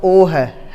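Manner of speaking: "ओ" said as a pure, drawn-out rounded o; plain long o. Shown as a demonstration. Teacher ruling out a vowel, "ओ" held as pure o. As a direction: stretch "ओ" as a clear o. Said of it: "आ और ओ के बीच".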